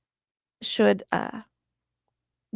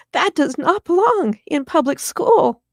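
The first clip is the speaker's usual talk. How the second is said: emphatic, higher and louder, slowly spaced; firm, raised-voice protest; indignant objection; 8 semitones higher, 6 dB louder, slower than usual. Acted. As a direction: lay down the line, word by word. Acting an outraged person's objection.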